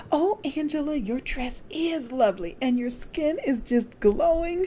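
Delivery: put-on high, delighted elderly woman voice; admiring widow friend. A narrator's reading. Widow compliments Angela's dress, high and delighted. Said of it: "she said".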